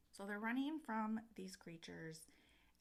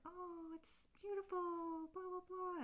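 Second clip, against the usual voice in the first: fairy voice; 13 semitones higher than usual. Retold or imitated. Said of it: "and they're like".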